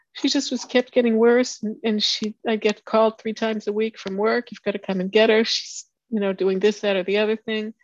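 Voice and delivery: tear-strained voice, steadier now; still tearful. Real, not acted. Speaker apologizing to the meeting, still tearful. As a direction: push on through the tears. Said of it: "sorry, I didn't think this was gonna happen".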